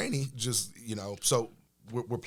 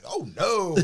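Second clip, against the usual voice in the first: mock alarm; 9 semitones higher, 7 dB louder than usual. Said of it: "And I'm like".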